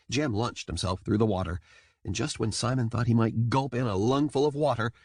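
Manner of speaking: usual speaking voice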